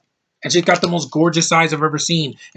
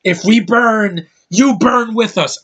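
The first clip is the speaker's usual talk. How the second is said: higher, louder, slow defiant rallying cry; Mockingjay Katniss quote; 7 semitones higher, 5 dB louder, slower than usual. Fan reenacting the Mockingjay rebel speech, slow and defiant. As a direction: deliver it like a rebel leader rousing a crowd. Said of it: "that Jennifer Lawrence from Mockingjay".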